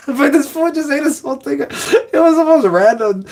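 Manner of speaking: squeaky high laugh running into the words; laughing disbelief. Real, not acted. Recalling the cheese guy to followers, laughing high. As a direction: crack up while saying it.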